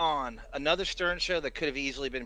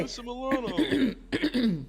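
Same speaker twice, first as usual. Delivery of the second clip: high character voice; 9 semitones higher than usual. Pretend. Continuing the character bit.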